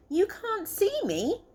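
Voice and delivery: high squeaky little squirrel. Acted. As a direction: play the cheeky tiny squirrel. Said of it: "Nutkin called back".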